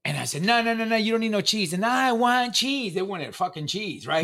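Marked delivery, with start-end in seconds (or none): 0.4-1.7 put-on raised, higher-pitched scolding of a child; firm parental refusal. Dad refusing daughters in retold quarrel. 1.8-3.0 put-on high, loud, drawn-out kid's demand; whining child. Imitates daughters whining back.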